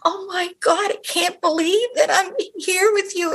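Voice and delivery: high-pitched retold gushing; high, awestruck fan impression; imitating trembling fan. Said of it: "like, trembling".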